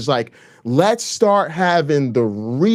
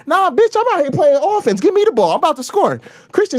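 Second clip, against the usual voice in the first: much higher, pumped-up taunting; hyped trash talk; 9 semitones higher than usual. Acted. Streamer taunting Christians with pumped-up trash talk. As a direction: taunt them like you've got the ball.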